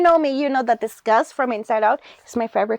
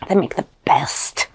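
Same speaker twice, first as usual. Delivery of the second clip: low, secretive whisper; 7 semitones lower than usual. Real, not acted. Confiding to the host about the best cosmo, whispered.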